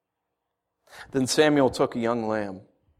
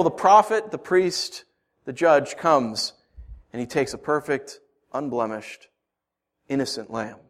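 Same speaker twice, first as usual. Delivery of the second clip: usual speaking voice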